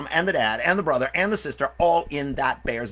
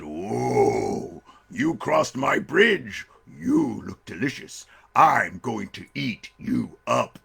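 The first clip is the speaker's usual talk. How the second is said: slow, drawn-out menacing bear; big storybook bear, very slow; hungry bear; much slower than usual. Acted. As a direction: savour each word like a hungry bear.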